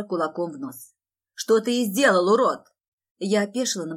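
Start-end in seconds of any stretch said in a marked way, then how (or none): 1.3-2.7 loud, outraged accusation; angry and loud; furious shout. Acted. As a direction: roar it at the man you are attacking. Enraged brother attacks the man.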